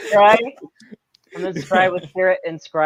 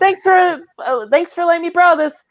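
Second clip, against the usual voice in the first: high, louder quoted thanks; acted character line; 10 semitones higher, 4 dB louder than usual. Co-host acting out a favorite scene, high acted voice. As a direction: pitch it up high like a cheery borrower.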